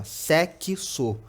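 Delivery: "sexo" broken slowly into sé-qui-so; syllable-by-syllable. Shown as a demonstration. Teacher showing the two sounds in "sexo", drawn-out syllables. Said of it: "É como a gente falasse assim".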